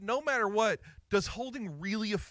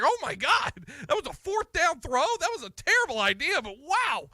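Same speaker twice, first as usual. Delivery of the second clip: higher, louder burst of retold amazement; amazed disbelief; 8 semitones higher, 4 dB louder than usual. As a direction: relive the shock of that throw. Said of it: "You're just like".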